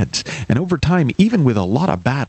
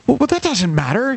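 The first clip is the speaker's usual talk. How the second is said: higher, eager cheerleader tone; higher encouraging voice; 12 semitones higher than usual. Acted. Helper urges the friend to keep flipping, higher and eager. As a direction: brush off his worry in a bright higher voice. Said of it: "you say".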